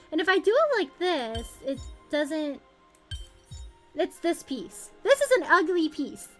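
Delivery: usual speaking voice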